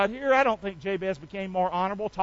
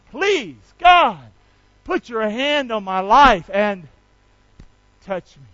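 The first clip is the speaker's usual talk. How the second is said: leading a prayer; 6 dB louder than usual. Shown as a demonstration. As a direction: call out then sink to a hush. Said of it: "Say the prayer part with me".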